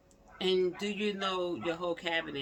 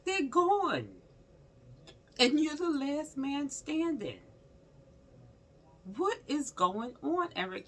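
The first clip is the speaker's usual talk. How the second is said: excited disbelief; 10 semitones higher, 4 dB louder than usual. Real, not acted. High loud disbelief.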